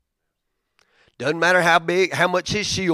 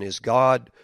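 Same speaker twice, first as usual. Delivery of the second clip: ordinary delivery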